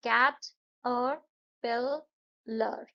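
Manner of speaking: कैट और पिलर said very slowly, part by part; syllables spelled out. Shown as a demonstration. Teacher splits कैटरपिलर into parts for pupils. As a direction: drag out कैट और पिलर piece by piece. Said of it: "कैटरपिलर में चार sound है".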